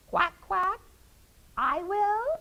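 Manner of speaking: very high, squawky duck. Acted. Duck friend eagerly offering to help. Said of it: "her duck friend said".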